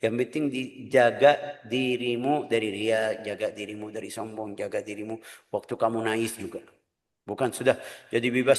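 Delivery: ordinary talk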